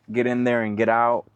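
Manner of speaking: ordinary talk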